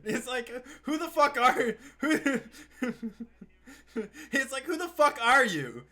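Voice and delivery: high, loud, laughing who-are-you; cracking up in loud disbelief. Real, not acted. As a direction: blurt it out through laughter. Laughing outburst at character.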